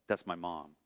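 low, soft voice in the back of the mind; quiet inner voice. Retold or imitated. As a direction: hush it like a sudden private thought. Inner voice sensing mom.

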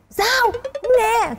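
high mock shout; mock teasing husband. Wife imitates husband teasingly pretending not to hear, high mock shout. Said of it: "ổng sẽ hỏi".

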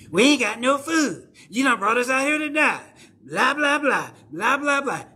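high, mocking whine of the grumbling people; whiny complaining crowd. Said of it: "'Cause I'm tired of y'all complaining all the time".

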